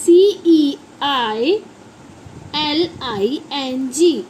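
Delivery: slow letter-by-letter spelling; measured classroom spelling. Shown as a demonstration. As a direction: spell it out one drawn letter at a time. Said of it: "ceiling fan होता है".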